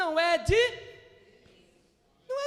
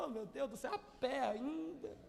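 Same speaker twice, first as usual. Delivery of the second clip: dismayed groan; 5 semitones lower, 11 dB quieter than usual. Retold or imitated. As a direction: sigh it out, defeated. Dismayed at walking.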